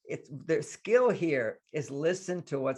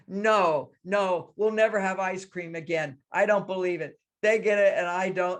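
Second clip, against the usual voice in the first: put-on high, loud child protest; mock-distraught child, pitched up; scared little girl; 5 semitones higher, 4 dB louder than usual. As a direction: imitate an upset kid refusing to believe it. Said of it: "you're scared and afraid".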